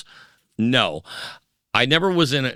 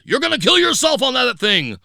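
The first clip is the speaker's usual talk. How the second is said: high, loud warning yell from a worried father; father's warning shout; 13 semitones higher, 5 dB louder than usual. Retold or imitated. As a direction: shout it like a scared father warning his son. Father's warning quoted in story.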